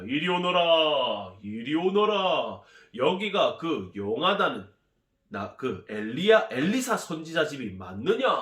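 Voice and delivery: loud, long-drawn hail at the gate; calling out. Acted. General calling at the prophet's door, commanding call. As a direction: announce yourself grandly, stretching the words.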